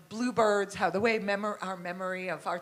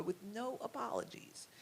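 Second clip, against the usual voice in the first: imitating Carrie; 10 dB quieter than usual. Speaker repeating how the painter would say it, hushed imitation. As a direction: say it softly, as he would. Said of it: "He would go".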